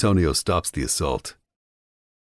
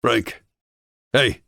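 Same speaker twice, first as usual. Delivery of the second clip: sharp bark, higher; barking at Frank; 8 semitones higher, 4 dB louder than usual. A narrator's reading. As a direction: bark his name to wake him. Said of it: "I bark".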